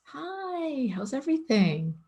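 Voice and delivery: high, sing-out fake-cheery greeting; put-on chirpy hello; fake-cheerful. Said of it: "trying to pretend like everything's okay".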